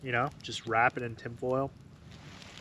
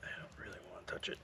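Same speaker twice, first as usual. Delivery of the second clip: quick breathy whisper; 16 dB quieter, much faster than usual. Real, not acted. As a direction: whisper it fast under your breath. Quiet remark about the hot potato.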